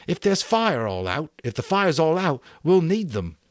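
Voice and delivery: high, loud urgent shout. A narrator's reading. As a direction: keep yelling the reason. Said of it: "He stopped shouting".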